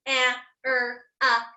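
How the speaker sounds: "A, er, ah" as separate sounds with gaps; sounded out. Shown as a demonstration. Teacher repeating camera's sounds for pupils, "A, er, ah" one by one. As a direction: isolate each sound in "A, er, ah". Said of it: "sand out the words one more time".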